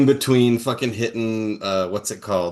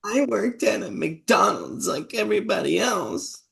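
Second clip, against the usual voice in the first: much higher put-on folksy politician voice; mocking campaign ad; 12 semitones higher than usual. Sick of the radio ads, mocks the candidate's line high and sweet. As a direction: parody a fake-humble ad in a high voice. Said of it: "I'm just so sick of hearing".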